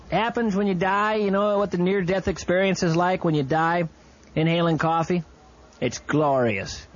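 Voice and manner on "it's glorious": dreamy. Acted. Dreamy reverie.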